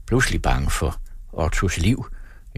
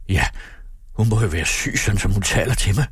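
ordinary delivery

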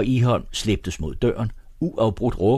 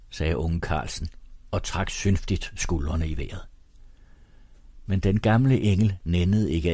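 ordinary delivery, nothing marked

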